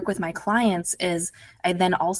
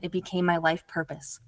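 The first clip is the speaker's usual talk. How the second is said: ordinary delivery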